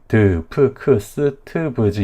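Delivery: "드, 프, 크, 스, 트, 브지" each as a full separate syllable; over-voice the eu after each consonant in "드, 프, 크, 스, 트, 브지"; choppy list of "드, 프, 크, 스, 트, 브지" with exaggerated vowels; Konglish added vowels. Shown as a demonstration. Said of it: "콩글리시로 너무 이렇게".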